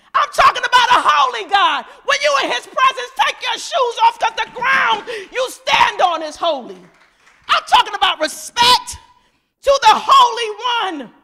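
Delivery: loud, high preacher's shout; full-force preaching. Real, not acted. Preacher demanding respect for a holy God, full-force shout. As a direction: drive it home at full volume.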